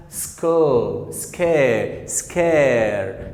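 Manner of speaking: "سكير" very slow, S into hard K. Shown as a demonstration. Teacher giving examples of S before C, slow drawn-out K words. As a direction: stretch "سكو" and "سكير" after the sk. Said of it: "تنطق ك".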